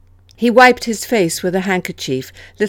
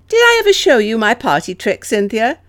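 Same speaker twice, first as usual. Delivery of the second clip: higher, louder, lively and bright; bright question; 5 semitones higher, 5 dB louder than usual. A narrator's reading. Alice offers Cynthia a party trick, asking brightly. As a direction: ask it brightly, pitched up. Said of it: "Alice asked brightly".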